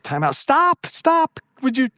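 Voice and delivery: high, urgent cry of stop; calling time out. Retold or imitated. Pastor breaking up a couple's fight, high urgent call.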